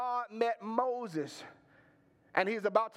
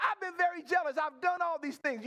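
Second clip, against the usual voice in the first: higher-pitched, insistent protest; protesting self-justification; 8 semitones higher than usual. Acted. Elijah lists his record to God, protesting in a raised pitch. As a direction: plead your record, voice lifted.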